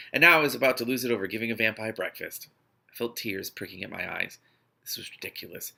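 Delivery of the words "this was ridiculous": barely voiced, under the breath; whispered. A narrator's reading. Narrator fighting tears, whispered. Unvoiced line after tears.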